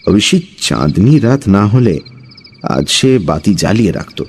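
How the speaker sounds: ordinary delivery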